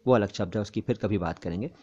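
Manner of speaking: ordinary talk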